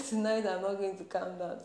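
ordinary talk, nothing marked

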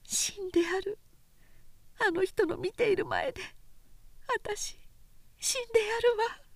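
high, soft bitter vow; a hurt woman's quiet threat; inner vow. A narrator's reading.

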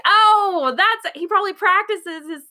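high-pitched excited "aha" moment; retold delighted discovery, high and giddy; excited realization. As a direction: let it click, voice jumping way up, ending in laughter. Retells own sudden realization, amused.

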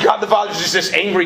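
ordinary delivery

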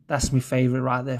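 ordinary talk

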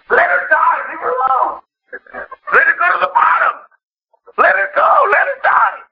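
high, strained cry of protest; exasperated calling out. Acted. Preacher warding off those comforting a seeker, exasperated cry. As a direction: plead urgently at the top of the range.

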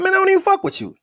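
dismissive brush-off. Shown as a demonstration. Telling a comedian he's nobody, a high scornful dismissal. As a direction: flick them away with the words. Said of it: "that we say that now".

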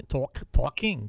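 slow Bronx "talking" with a drawn-out vowel; Bronx accent. Shown as a demonstration. Host shows his Bronx accent to co-host, slow Bronx drawl. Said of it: "That's the accent".

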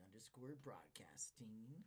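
slow whisper. Acted. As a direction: breathe it slowly. Whispered plug.

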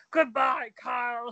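loud mock farewell shout; quoted catchphrase. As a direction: call out the famous line. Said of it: "is the new".

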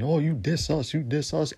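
sneering imitation of the haters; mocking whine. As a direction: whine it back at them mockingly. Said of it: "and saying".

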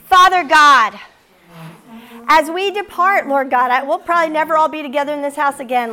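loud, fervent preacher's prayer; closing prayer. Real, not acted. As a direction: pray over the room with full force.

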